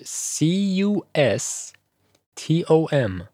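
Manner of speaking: slow spelling of c u s t o m, letter by letter; name each letter slowly and separately; spelled out letter by letter. Shown as a demonstration. Teacher spelling the word for students, letter by letter. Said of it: "سپلینگش به این صورت هستش".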